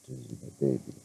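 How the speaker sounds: soft, low coo; cooing to dog. Real, not acted. Owner cooing softly to her chihuahua. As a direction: coo over your little dog.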